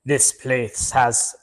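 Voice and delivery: put-on slow, halting French-accented start; bad French accent. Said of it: "that's not the French person, that's [name]".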